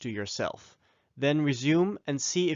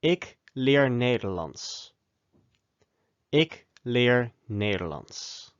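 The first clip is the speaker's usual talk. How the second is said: "Ik leer Nederlands" slowly, word by word; slow it down for repeating; slow model phrase; slower than usual. Shown as a demonstration. Teacher models Ik leer Nederlands slowly to learners. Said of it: "how would you say".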